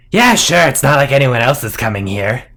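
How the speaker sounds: quick-talking snake guard; snake-headed guard. Acted. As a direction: answer as an easygoing monster sentry. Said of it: "The two snakeheads look at each other, and say".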